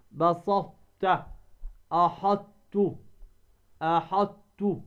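slow "بسطت", "أحطت" with heavy T held into light t; emphatic ta merged into ta. Shown as a demonstration. Teacher drilling examples, slow أحطت with ط merged into ت. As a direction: hold ط unreleased, then release ت in أحطت. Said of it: "تدغم الطاء".

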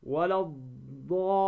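"ولا الضالين" with silent article L, doubled ḍ and long drawn aa; lam assimilated. Shown as a demonstration. Tajwid teacher reciting an example, long "ولا الضالين" without article L. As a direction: merge into the ḍ and stretch the vowel of "الضالين". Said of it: "ولا تقل الضالين".

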